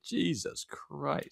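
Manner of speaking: slow, soft, drawn-out groan of disbelief; exasperated "Jesus Christ". Retold or imitated. Friend retelling her reaction, soft slow disbelief. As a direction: let it sag out slowly, half under breath. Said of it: "I was just like".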